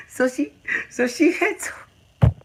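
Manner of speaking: high, slow squeak through laughter; helpless laughter. Real, not acted. Host trying to explain a nomination, breaking up laughing. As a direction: squeeze the words out while cracking up. Breaking up over joke award.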